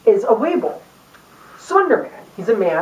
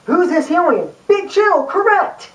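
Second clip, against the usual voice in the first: higher, peppy quiz host; excited quiz host; 7 semitones higher than usual. Retold or imitated. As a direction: announce the answer like an eager kids' show host.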